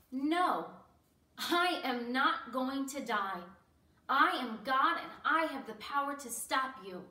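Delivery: loud, forceful and emphatic; defiant refusal. Acted. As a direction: refuse them with ringing authority. Imagined defiant refusal by Jesus.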